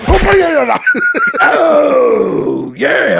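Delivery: high, loud, laughing shout; laughing oh yeah. Real, not acted. Laughing with a friend over the movie, a loud laughing shout. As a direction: burst out laughing as you say it.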